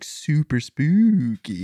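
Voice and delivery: slow, drawn-out "ooo" in spooky; mock spooky. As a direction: stretch it like a campfire ghost. Hamming up the spookiness.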